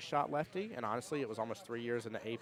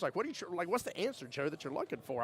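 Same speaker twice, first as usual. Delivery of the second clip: frustrated; 7 semitones higher than usual. Retold or imitated. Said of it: "I literally got frustrated with him".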